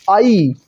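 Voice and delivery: "आई" drawn out as two gliding vowels; correct pronunciation. Shown as a demonstration. Teacher showing learners the correct sound, slow careful "आई". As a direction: say "आई" slowly, both vowels heard. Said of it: "सही उच्चारण इसका करना चाहिए".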